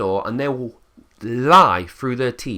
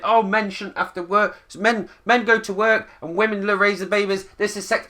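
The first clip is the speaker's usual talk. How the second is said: much higher mocking voice; mocking commenters; 8 semitones higher than usual. Mocking the men-work argument of commenters, much higher. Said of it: "stop saying".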